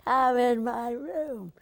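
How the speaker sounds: slow, loud, high, drawn-out summons; Bette Davis beckoning. Retold or imitated. Bette calling the young actress into her room, slow and grand. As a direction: beckon grandly like a stern old star. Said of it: "she'd say".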